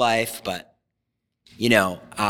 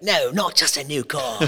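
put-on English accent. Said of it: "I'm doing an English accent".

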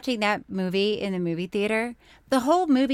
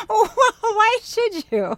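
laughing; 14 semitones higher, 4 dB louder than usual. Real, not acted. Laughing at co-host's apology.

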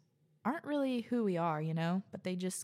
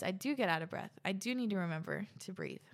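ordinary talk, nothing marked

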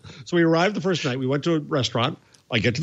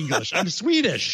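higher-pitched mock-exasperated Swede; a hint of an irritated Swedish waiter; annoyed Swede; 7 semitones higher, slower than usual. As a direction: play the local, fed up with a pointless question. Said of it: "He looks at me like".

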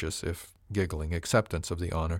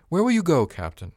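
much higher, louder interjection; interjected question; 10 semitones higher, 7 dB louder than usual. A narrator's reading. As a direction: break in over the conversation. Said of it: "Avery's voice broke in".

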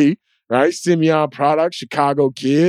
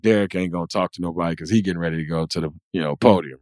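low, fast mock player voice; imitated player chatter; 11 semitones lower, 5 dB quieter, faster than usual. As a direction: mutter it low and quick like a player. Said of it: "they're like".

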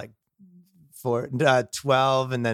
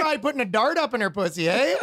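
mock Canadian accent; 10 semitones higher than usual. Mocking a Canadian guy.

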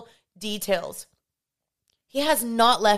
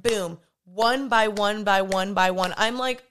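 ordinary talk

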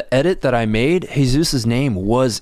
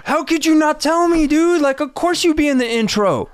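shocked outcry. Retold or imitated. Outraged at friend left out.

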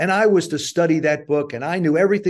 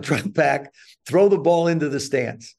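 ordinary talk

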